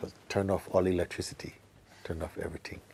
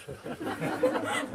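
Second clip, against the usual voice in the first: a burst of high unvoiced laughter. Real, not acted. Teacher laughing at his own lonely joke, high giggle. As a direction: giggle at yourself.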